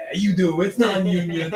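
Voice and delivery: low, blunt producer voice; a dismissive big shot laying down terms; gruff producer. Acted. Dick Wolf telling the pony the job is non-union, low.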